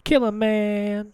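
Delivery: slow dramatic echo. Pretend. Echoing a rap lyric.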